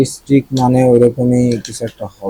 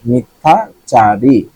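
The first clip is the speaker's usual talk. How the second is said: usual speaking voice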